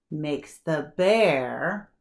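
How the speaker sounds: usual speaking voice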